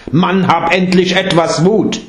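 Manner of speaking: a touch of stern urging; firm urging. Acted. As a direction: push him to find courage. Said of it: "aber fest an". (eindringlicher Appell, leicht streng)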